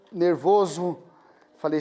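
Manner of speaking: normal talking voice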